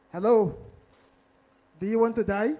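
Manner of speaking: threatening phone caller with a hint of a Russian accent; mock Russian accent. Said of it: "in a Russian accent".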